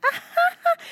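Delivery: high tinkly society laugh; a dainty put-on giggle; Southern belle polite titter; polite fake laugh. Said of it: "she's got this laugh".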